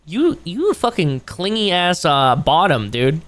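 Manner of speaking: ordinary talk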